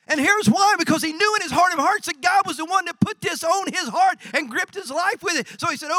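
high, loud, driving sermon delivery; full-force preaching. Real, not acted. Preacher telling why Nehemiah turned to God, full-force preaching. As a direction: drive it home high and loud.

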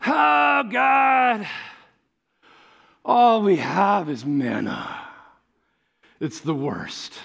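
mock the grumbling Israelites; whiny complaint. Preacher mimicking grumbling Israelites, whiny moan.

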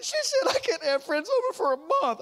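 high-pitched mock wail; imitated crying child. Daughter telling dad her punishment, crying. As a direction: wail it like a heartbroken kid. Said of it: "she's crying".

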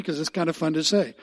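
normal talking voice

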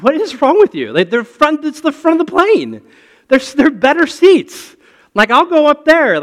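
mock-indignant, can't believe nobody moves; loud, high disbelief at stubborn passengers; exasperated outburst. Retold exasperated outburst on plane.

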